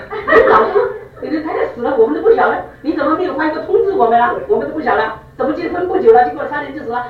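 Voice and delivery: shocked student. Retold or imitated. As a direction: gasp it out in mock shock. Student shocked wife died.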